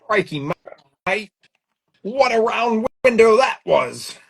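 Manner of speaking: crikey it up in a hammed-up Australian accent; mock Aussie accent. Host joining the joke in a hammed-up Australian accent. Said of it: "I can't do the British accent".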